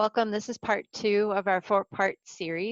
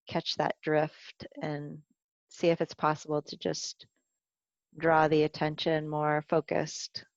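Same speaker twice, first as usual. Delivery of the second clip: guided meditation. Acted. Teacher guiding a body scan for the class, calm meditation voice. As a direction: ease listeners down into the body, gently.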